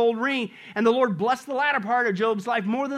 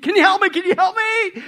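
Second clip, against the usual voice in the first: loud, high begging for help; frantic pleading; 8 semitones higher, 9 dB louder than usual. Acted. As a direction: plead as if hanging on. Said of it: "the tourist, he pleaded".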